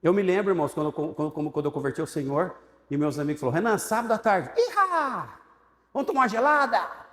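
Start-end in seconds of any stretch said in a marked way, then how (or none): 3.6-7.1 hammed-up tempting friend; teasing party buddies. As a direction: lure him out like a rowdy drinking buddy. Friends tempting him, mocking tone.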